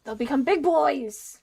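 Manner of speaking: loud excited exclamation; wild unhinged ranting. Real, not acted. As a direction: blurt it out with glee. Excited outburst.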